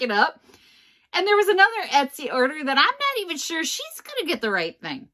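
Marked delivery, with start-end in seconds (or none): none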